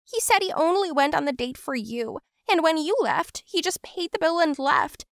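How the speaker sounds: tearful. Acted. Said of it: "and she cried bitterly".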